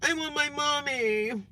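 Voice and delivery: put-on high, drawn-out childish whine; mock whiny toddler. Mimicking the caller as a kid having a fit, whiny. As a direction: whine it slowly like a pretend little kid. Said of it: "Like a little kid at a daycare having a fit".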